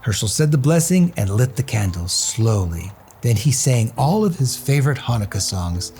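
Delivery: plain reading voice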